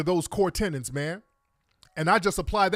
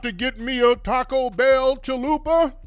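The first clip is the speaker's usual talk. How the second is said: mocking a put-on preacher voice, louder and higher; fake MLK preacher voice; 4 semitones higher, 5 dB louder than usual. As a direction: ham up a fake Doctor King speech, stretched and loud. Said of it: "the fake Doctor Martin Luther King voice".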